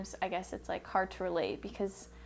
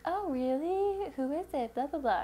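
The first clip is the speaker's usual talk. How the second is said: mock intrigued mum; 7 semitones higher than usual. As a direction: imitate an intrigued mum, pitched up. Retold mother's interested reply to news.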